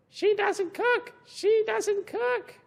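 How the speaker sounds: squawky high parrot, slow. Acted. Teacher reading the parrot's line to kids, high parrot squawk. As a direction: chirp it like a parrot.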